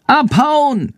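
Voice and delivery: high screeching creature cry; shrieking bat voice. A narrator's reading. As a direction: screech the warning. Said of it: "Chandors kreischende Stimme".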